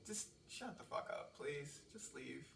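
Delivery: quiet, half-whispered get lost; dismissive brush-off. Retold or imitated. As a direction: shoo them away under your breath. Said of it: "I'm just like".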